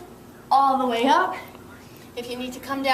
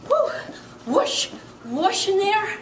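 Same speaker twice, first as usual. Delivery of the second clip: whooshing sound effect; 6 semitones higher, slower than usual. Pretend. Playful skiing whoosh sounds.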